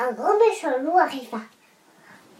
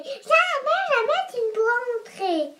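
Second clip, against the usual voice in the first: defiant little pig; 6 semitones higher than usual. Acted. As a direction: shout it back like a brave piglet. Pig refusing the wolf.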